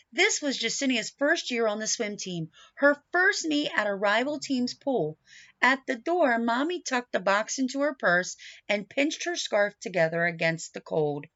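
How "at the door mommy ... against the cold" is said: ordinary delivery, nothing marked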